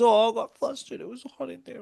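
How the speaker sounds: high, mocking his excuse; mocking him. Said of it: "He goes".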